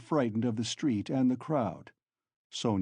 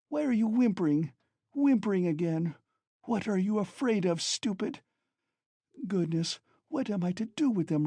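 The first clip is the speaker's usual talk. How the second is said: near tears; 7 semitones higher than usual. Acted. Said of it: "almost crying herself".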